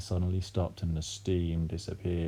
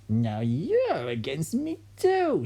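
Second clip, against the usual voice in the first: slow, high, put-on old woman's voice; grandma accusing her daughter; grandma's accusing shout; 8 semitones higher, much slower than usual. A narrator's reading. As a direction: drag out the complaint. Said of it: "shouted Grandma".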